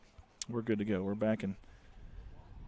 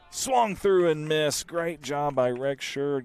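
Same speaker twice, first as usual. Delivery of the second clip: excited strikeout call; 5 semitones higher, 6 dB louder, slower than usual. Real, not acted.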